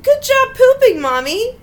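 put-on playful yelled child praise; mock child yell. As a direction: cheer it out like a proud little kid. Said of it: "my child yelled".

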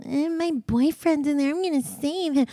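put-on high, whiny voice of an annoying girl; mock her in a silly high voice. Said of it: "that stupid girl wanted to be all".

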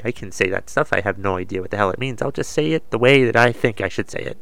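normal talking voice

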